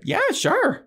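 very high, bright squeak; a startled eager yes; chirpy Cordelia. Retold or imitated. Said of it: "And Cordelia's like".

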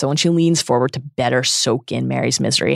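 ordinary delivery

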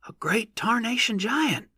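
slow, very high rustic awe; subdued exclamation. A narrator's reading. Onlooker answering the rear, subdued exclamation. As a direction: draw out the wonder in a high voice. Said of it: "said the first voice".

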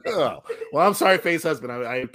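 ordinary talk, nothing marked

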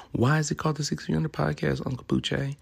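high, quick questioning voice; curious listener voice. Retold or imitated. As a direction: ask like an eager fan. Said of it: "imagined listener asking".

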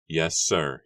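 plain reading voice

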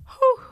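loud, high mock sob; imitated crying. Retelling crying on the phone to manager, mock sobbing. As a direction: act out breaking down in tears. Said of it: "I broke down and cried on the phone".

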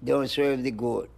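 normal talking voice

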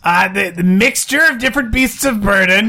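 high, pleading put-on voice; distressed trapped character. Character stuck to the ceiling reporting horrors, distressed.

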